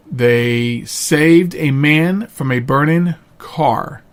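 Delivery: usual speaking voice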